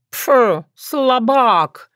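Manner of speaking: high, scornful cat scoff; contemptuous. A narrator's reading. Cat mocking the timid lad, contemptuous. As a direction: dismiss him with a puff of scorn. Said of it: "презрительно высказался Баюн".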